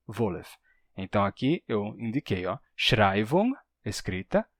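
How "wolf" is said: "Wolf" as a soft, low VO-lef; pronúncia hunsriqueana. Shown as a demonstration. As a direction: slip a short e between L and F in "Wolf". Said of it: "quando bate um L com F". ("Wolf" em duas sílabas, "Volef")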